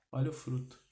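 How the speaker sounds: softly spoken exhortation, kept level; hushed, restrained urging; quiet repeat. Real, not acted. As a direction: drop the volume and say it soberly. Preacher repeats exhortation, then quietly.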